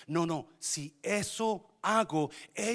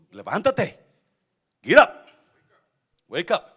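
loud, higher barking wake-up call; mock alarm shout; 3 semitones higher, 16 dB louder than usual. Pastor telling how he wakes himself, loud shout. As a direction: shout yourself awake. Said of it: "si me quedo dormido".

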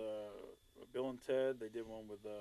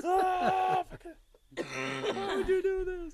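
high, laughing yelps; laughing panic; much slower than usual. Retold or imitated. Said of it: "He's like".

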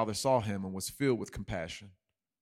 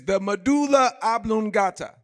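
much higher, louder Waterboy blurt; 11 semitones higher, 9 dB louder than usual. Retold or imitated. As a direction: shout the big words back eagerly. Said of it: "and then he says".

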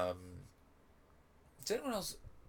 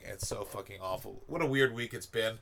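ordinary delivery

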